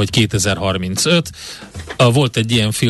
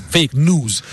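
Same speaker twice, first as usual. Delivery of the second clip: New York accent; 3 semitones higher than usual. Shown as a demonstration. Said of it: "hogyha New Yorkiasan mondanád".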